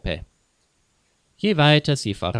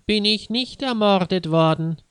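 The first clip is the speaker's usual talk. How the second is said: much higher mock whiny voice; mocking imitation; 10 semitones higher than usual. Maffei mocks Jonas's words in a much higher squeaky voice. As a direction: ape the boy by squeaking it up high. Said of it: "äffte Herr Maffei ihn nach".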